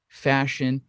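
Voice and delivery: ordinary delivery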